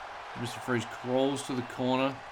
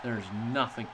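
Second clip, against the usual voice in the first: low, soft somber commentary; wrestling commentator; 3 semitones lower, 5 dB quieter than usual. Acted. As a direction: mourn the fallen wrestler softly. Mourns Krillin's in-game death.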